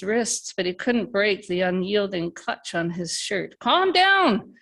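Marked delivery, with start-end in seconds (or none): 3.6-4.5 much higher and louder desperate shout. Acted. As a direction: yell it to stop the attack. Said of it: "he shouted".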